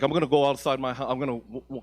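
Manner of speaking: ordinary talk, nothing marked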